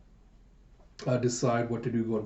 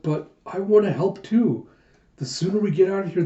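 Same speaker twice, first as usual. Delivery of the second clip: higher-pitched, quickening protest; lightly voiced determined girl; insistent pleading; 6 semitones higher than usual. A narrator's reading. Yona insists to Gija on helping dig, pleading.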